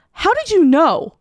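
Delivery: high, slow, wide-eyed How did you know; drawn-out delighted disbelief; stunned and thrilled by the gift; amazed gasp of delight. Retold or imitated. Touched by boyfriend's mom's gift.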